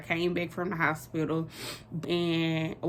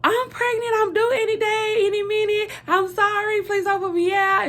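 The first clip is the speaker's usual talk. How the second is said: very high, loud frantic squeal; acted-out hysterical pleading; nervous, panicked confession; 6 dB louder than usual. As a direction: blurt it out in a pleading wail. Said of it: "nervous and shaking".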